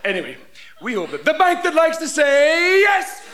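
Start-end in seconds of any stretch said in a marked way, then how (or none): 1.2-3.2 ad voice. Acted. Mock ad slogan.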